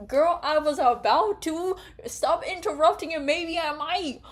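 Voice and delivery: high, loud fed-up rant; exasperated rant. Real, not acted. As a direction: vent at people who interrupt. Ranting at interrupters.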